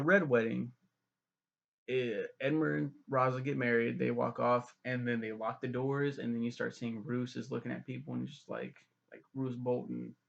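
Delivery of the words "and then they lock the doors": usual speaking voice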